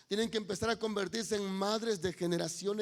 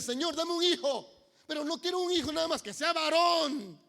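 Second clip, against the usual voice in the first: fervent prayer; 7 semitones higher, 5 dB louder than usual. Acted. Ana praying differently for a son, fervent higher louder plea. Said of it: "Ana empieza a orar de manera diferente".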